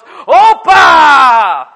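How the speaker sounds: the whole room shouting it back; group shout. Retold or imitated. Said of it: "everyone else goes".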